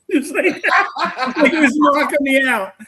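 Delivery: high, loud talk through big laughter; laughing. Real, not acted. Teller amused by the spirit's comeback, laughing hard. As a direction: crack up while getting the words out.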